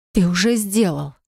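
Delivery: anguished moan. A narrator's reading. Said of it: "Стонет с надрывом".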